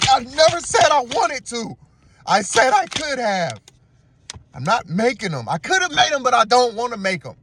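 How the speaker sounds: high-pitched, hammed-up protest; mock indignant outburst. Boyfriend refusing to make the tacos, high mock indignation. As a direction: act out an exasperated, high refusal.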